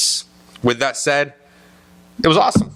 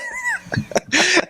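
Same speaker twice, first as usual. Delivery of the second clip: high, breathless giggling laugh; high laughing fit. Real, not acted. Host cracking up at the Mulaney scene, high giggle. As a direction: dissolve into helpless laughter.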